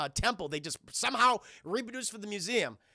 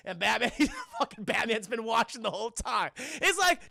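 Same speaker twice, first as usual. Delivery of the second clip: ordinary delivery, nothing marked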